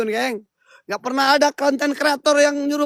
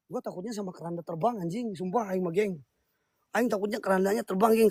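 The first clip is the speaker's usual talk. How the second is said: much softer, quick scared aside; hushed confession; 3 semitones lower, 8 dB quieter than usual. Real, not acted. Ghost hunter admits his fear to viewers, hushed and quick. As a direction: drop to a hush and rush it.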